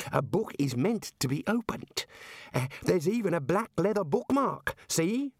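high, helpful boffin voice; eager young academic wizard. Acted. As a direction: point it out with bright-eyed enthusiasm. Ponder reasons about the book.

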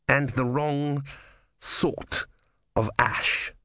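halting, measured pronouncement; lightly voiced menace, word by word; ominous Vorbis. A narrator's reading. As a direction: leave a pause between phrases. Vorbis coldly examines the ashes.

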